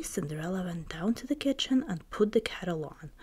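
usual speaking voice

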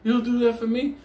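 put-on old lady thrilled he'll fetch Georgie, high and delighted. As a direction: squeal it like a grateful grandma. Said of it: "She's like".

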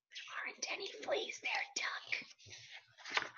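very soft, unvoiced whisper to the duck; hushed little whisper; whispered aside.

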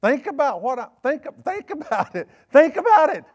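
rising from firm to a high, loud call; building exhortation. Real, not acted. Preacher urges the church to think, building to a loud call. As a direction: build it up until you're shouting.

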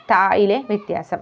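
normal talking voice

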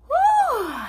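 high sharp whew from spice; burning exhale. Real, not acted. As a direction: blow out the heat high. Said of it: "you can feel it".

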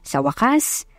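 ordinary delivery, nothing marked

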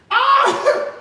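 high cackle ending in a squeal; high giggling laugh. Real, not acted. Laughing at her own joke to viewers, high giggle. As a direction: giggle high, then let out an "ah".

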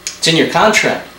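ordinary talk, nothing marked

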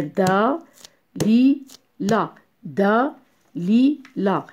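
Da, li, la as separate beats; syllable by syllable. Shown as a demonstration. Teacher breaks a word for pupils, Da, li, la separated. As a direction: say Da, li, la one syllable at a time. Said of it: "Oui, trois fois".

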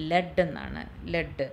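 ലെഡ് with a short e; ലെഡ് as "led", short vowel; past-tense pronunciation. Shown as a demonstration. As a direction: say ലെഡ് to rhyme with "bed". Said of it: "past tense എന്ന് പറഞ്ഞിട്ടുണ്ടെങ്കിൽ".

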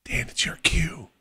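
whispered prompt, unvoiced. Real, not acted. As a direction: hiss the cue under your breath. Prompting a friend during recording.

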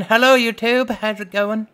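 loud, high greeting; voicing sister's message. Player reads sister's text to YouTube, loudly voiced greeting. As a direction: call it out as someone else's hello. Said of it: "She's saying".

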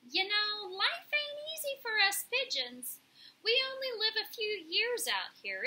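streetwise pigeon remembering a lost friend; rueful pigeon. A narrator's reading. Pigeon mourns Graybeak.

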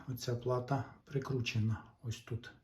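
ordinary delivery

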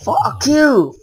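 real rage, shouted out; angry shout. Real, not acted. Cursing at the game.

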